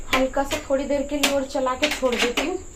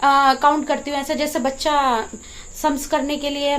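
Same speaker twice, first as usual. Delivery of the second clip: normal talking voice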